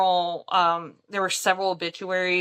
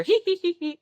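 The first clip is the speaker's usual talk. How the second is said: high cackling hee hee; witch cackle imitation; 10 semitones higher, faster than usual. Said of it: "sounds like a witch cackle".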